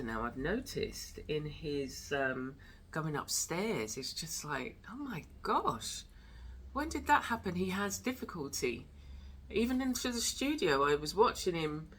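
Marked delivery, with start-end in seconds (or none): 4.9-7.5 surprised. Real, not acted. Shocked at dog's aging.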